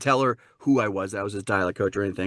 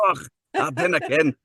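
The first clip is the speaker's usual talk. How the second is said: higher, confident Scottish delivery; Scottish accent demo; 4 semitones higher than usual. Shown as a demonstration. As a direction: show how a Scot says it. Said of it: "that's quite an accent to dive in with".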